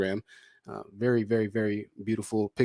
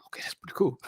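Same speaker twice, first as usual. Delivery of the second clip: whisper; 7 semitones higher, 3 dB quieter than usual. Real, not acted. Impressed aside to guest.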